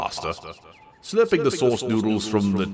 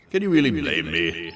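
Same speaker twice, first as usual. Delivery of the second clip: ordinary delivery, nothing marked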